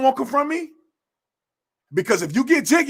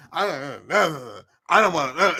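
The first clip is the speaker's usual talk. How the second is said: a stammering, mumbled whine, much lower and softer; mock whiny complainer; 8 semitones lower, 5 dB quieter than usual. Mocking scumbags who complain, in a stammering whine. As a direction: mimic a lazy complainer whining.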